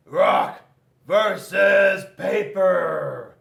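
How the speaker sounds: shouted, drawn-out challenge; loud battle cry. Acted. Rock declares the fight on Scissors, loud battle cry. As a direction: bellow the matchup like a ring announcer.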